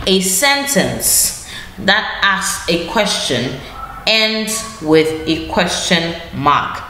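each word drawn out slowly for the class; slow dictation. Shown as a demonstration. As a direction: dictate it slowly so pupils can write. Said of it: "teacher repeats rule slowly, dictating".

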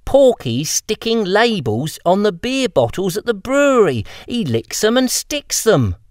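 put-on high girl voice explaining to dimwits; exasperated girl. Freckles explaining the label job to the boys, high exasperated girl voice. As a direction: spell it out to the daft boys.

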